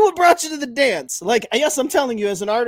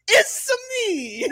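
higher Mario-style "It's me"; laughing Mario catchphrase; Italian "it's me"; 9 semitones higher than usual. Shown as a demonstration. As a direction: squeak it like Mario. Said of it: "the Italian impression ... you start with".

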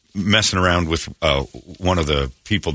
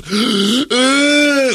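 loud, high frightened gasp; sharp horrified kid gasp; child's gasp; 4 dB louder than usual. Retold or imitated. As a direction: gasp like a scared little boy. Kid reacts in story.

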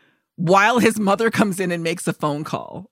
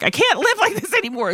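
mock distraught mother; 13 semitones higher, faster than usual. Imitating mother finding the staged blood, high mock despair. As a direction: throw a theatrical fit at a much higher pitch.

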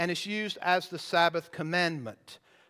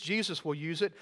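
ordinary talk

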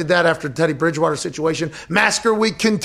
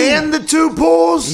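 higher, slow, savoring each word; mock grand finale; 10 semitones higher, 3 dB louder, much slower than usual. Host finishing Jacksonville's pitch, mock grandiose and slow. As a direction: land the punchline like a showman.

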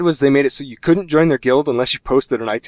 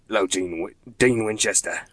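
low, soft English accent; Crowley, English accent; 3 semitones lower, 4 dB quieter than usual. Shown as a demonstration. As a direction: attempt a deep-voiced English villain. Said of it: "working on my Crowley version of the English accent".

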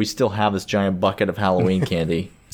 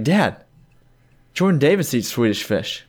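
put-on higher-pitched retelling of a kid explaining; kid's voice, pitched up; imitated earnest son; 4 semitones higher than usual. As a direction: lightly play a son setting dad straight. Retelling son's explanation to dad.

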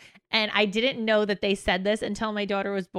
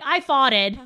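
higher, louder proud little announcement; child announcing it; 4 semitones higher, 6 dB louder than usual. Retold or imitated. Mom imitating her kid's announcement to everyone, loud. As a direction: imitate a kid loudly announcing it. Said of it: "she just is the one to announce it".

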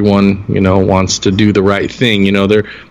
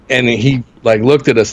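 ordinary delivery

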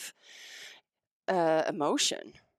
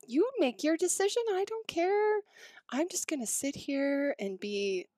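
mock perfect tone; 8 semitones higher than usual. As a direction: put on an exaggeratedly unbothered, controlled voice. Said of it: "this perfect tone in my voice".

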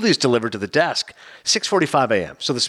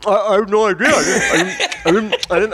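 higher, stammering through mock food; imitated mouth full; 4 semitones higher than usual. Eater caught like a kid at cookie jar, mock full mouth. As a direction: stammer guiltily as if caught mid-bite. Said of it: "eating with your mouth full".

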